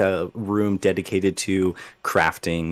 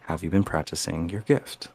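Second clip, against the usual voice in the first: low, soft grandmother voice in the head; a quiet, stern elder asking again; grandmother's mind voice; 3 semitones lower, 6 dB quieter than usual. Acted. Grandmother repeats her question through the mind, low and soft. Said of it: "she says with her mind".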